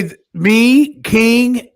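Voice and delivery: usual speaking voice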